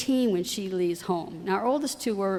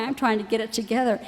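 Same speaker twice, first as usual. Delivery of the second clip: ordinary talk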